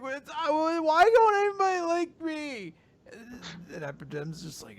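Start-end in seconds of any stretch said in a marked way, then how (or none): 0.0-2.7 mock wail. Voicing Dot wailing over girls.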